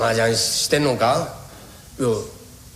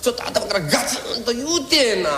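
lightly higher, nagging wife voice; a hint of a fretting housewife; wife's complaint; 8 semitones higher than usual. Wife nags husband about the son's smoking, lightly higher and fretful.

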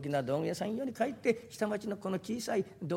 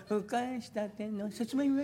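slow, soft, higher vendor call; soft steamed potato cry; 6 semitones higher, slower than usual. Retold or imitated. Vendor selling steamed sweet potatoes, soft gentle cry. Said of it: "湯気の出てるように柔らかそうに".

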